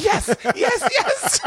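very high, gleeful squeals; mock overjoyed. Coaching a woman to fake accepting a proposal, hammed-up joy. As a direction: act out ecstatic acceptance, pitch sky-high.

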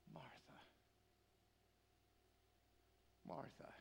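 hushed, heartbroken calling of a name; Jesus, tearful. Retold or imitated. Jesus to a woman he loves, softly through tears. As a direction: breathe each name softly, long pause between. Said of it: "through his tears, he goes".

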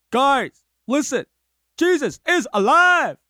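high, loud street-preacher call; preachy proclaiming. Pretend. Man on a crate preaching to passersby, high proclaiming call. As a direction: proclaim it to the crowd through a mic. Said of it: "I don't know why you say it like that".

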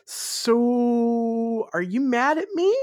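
high, drawn-out timid voice, mock passive-aggressive; meek Voldemort. Voldemort timidly checking in with Snape, meek high impression. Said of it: "like, really meekly doing the".

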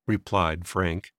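ordinary delivery, nothing marked

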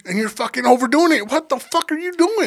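high, exasperated self-rebuke; self-scolding. Retold or imitated. Injured lifter berates himself for overdoing it, exasperated. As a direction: act out berating yourself, rising to the last question.

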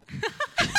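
big high laugh, cracking up at own joke; loud burst of laughter. Real, not acted. Laughing at her own crack pipe joke, loud burst.

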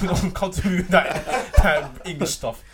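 laughing loud complaint; laughing rant. Real, not acted. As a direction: rant while cracking up. Laughing rant about being claimed.

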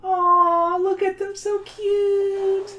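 put-on high, sweet girl's voice; a little girl gushing over kittens; cooing little girl. Young girl peeking into a backpack at cats, cooing high.

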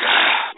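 long weary exhale; resigned sigh. Retold or imitated. Husband reacting to being put off till tomorrow, resigned sigh. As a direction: sigh as if giving in. Reluctant reply to another delay.

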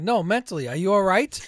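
loud, high, pointed retold question; exasperated sarcastic comeback; sarcastic jab. Retold sarcastic comeback, pressing the insult.

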